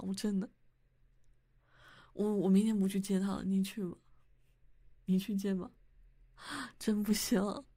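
normal talking voice

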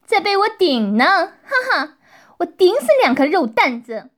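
high-pitched, crowing taunt; gloating taunt. Acted. Policewoman attacking a prisoner, gloating. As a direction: crow over a beaten opponent with a ha-ha.

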